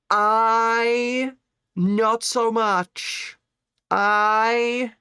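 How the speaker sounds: high squeaky put-on voice, slow; squeaky cartoon voice; silly high voice. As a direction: play a tiny cartoon character. Silly voice answering chat.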